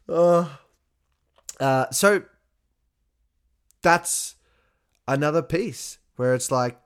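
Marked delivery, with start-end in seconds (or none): none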